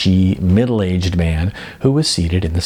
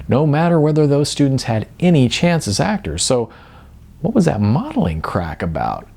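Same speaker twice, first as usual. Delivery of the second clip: ordinary delivery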